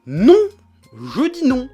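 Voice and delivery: loud sharp refusal; shouted no. Real, not acted. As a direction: bark a loud no. Ranting, refusing outright.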